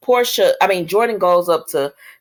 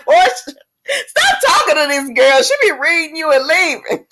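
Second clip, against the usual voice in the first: louder and higher, scolding the screen; calling out at TV; 9 semitones higher, 4 dB louder than usual. Real, not acted. Scolding Portia to stop talking to Jordan, loud call. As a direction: tell Portia off through the TV.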